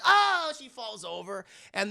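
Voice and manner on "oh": high, loud imitated yelp; mock cry of a fall. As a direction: yelp as if toppling over, hammed up. Imitates Laura crying out as she falls.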